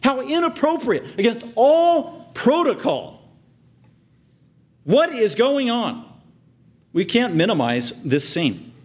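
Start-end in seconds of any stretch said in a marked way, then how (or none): 0.0-6.1 much higher, indignant protest; scandalised onlooker. Acted. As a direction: gasp out disapproval of the breach of protocol. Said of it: "people there thinking that".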